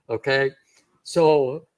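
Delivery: ordinary talk, nothing marked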